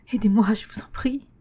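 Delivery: breathless whispered begging; whispered plea. A narrator's reading. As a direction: beg in a frightened whisper. Xavier smeekt fluisterend.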